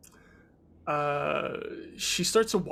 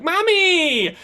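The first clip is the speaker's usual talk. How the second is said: put-on high, loud child's cry of joy; excited child; 10 dB louder than usual. Little Rue shouting to her mommy. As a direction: squeal it like a small kid seeing mom.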